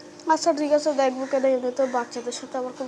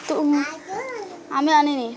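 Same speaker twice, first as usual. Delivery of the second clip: ordinary talk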